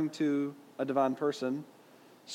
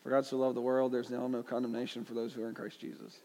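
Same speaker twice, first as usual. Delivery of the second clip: lower, softer rote verse quoting; quick, monotone recital trailing softer; accidentally morphed recitation; 4 semitones lower, 8 dB quieter, faster than usual. Acted. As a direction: run one verse into another without noticing. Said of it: "quoting one verse and you kinda just accidentally morphed it".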